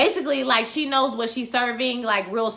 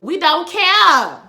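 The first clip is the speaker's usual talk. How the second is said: We don't care said slow, high and loud; slow dismissive; 7 semitones higher, 9 dB louder, much slower than usual. Real, not acted. Host dismissing Gabriel's storyline to viewers, slow and emphatic. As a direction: stretch out We don't care, done with it. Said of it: "Don't nobody care".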